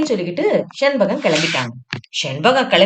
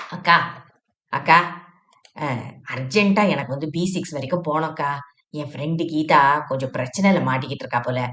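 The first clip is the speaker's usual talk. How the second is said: a touch lower and softer, polite traveller; gently lowered voice asking to be seated; lightly voiced Shenbagam; 6 semitones lower, 5 dB quieter, faster than usual. Shenbagam asking the seated woman to move, polite and low.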